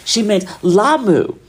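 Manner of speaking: imitated friend working it out; retold realization. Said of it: "And she was like".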